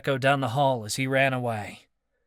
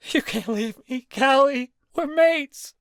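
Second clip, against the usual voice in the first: much higher, louder begging; desperate pleading; 8 dB louder than usual. Acted. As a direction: beg her not to leave. Marcus begging on his knees.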